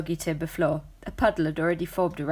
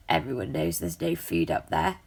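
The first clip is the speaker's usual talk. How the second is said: much lower, gruff; deep gruff cat voice; 12 semitones lower than usual. A narrator's reading. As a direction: growl it like a street cat.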